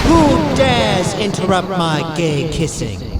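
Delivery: slow, loud ghostly demand; slow booming spirit voice. Acted. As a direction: rise up and boom as an angry spirit. Ghost rises from grave.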